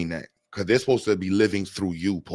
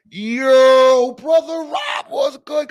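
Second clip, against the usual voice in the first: high, loud shout up to a window; calling from the window; 9 dB louder, much slower than usual. Pretend. Streamer hollering Brother Rob's name as if from a window. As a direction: yell it out like hollering up at a neighbor's window. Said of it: "Gotta call it from the window".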